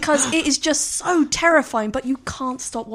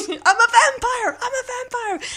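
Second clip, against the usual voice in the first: unhinged, very high-pitched movie quote; Nicolas Cage; 13 semitones higher, 4 dB louder than usual. Acted. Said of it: "I'm gonna go for Vampire's Kiss".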